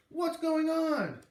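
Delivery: alarmed shout. A narrator's reading. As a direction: cry out in shock. Startled girl shouting.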